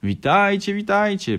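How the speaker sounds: high, loud hearty hello; grandfather's greeting. A narrator's reading. Grandfather arriving at the family's door, loud hearty greeting. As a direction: hail them loudly, voice swung up. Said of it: "przywitał się dziadek Antoni".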